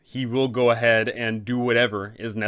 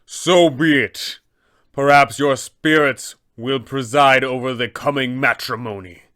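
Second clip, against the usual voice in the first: a calm but loud final warning before the battle; abbot accepts the fight; 5 dB louder than usual. Acted. Abbot answers the attacking party with grave loud resolve. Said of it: "he will go ahead and say".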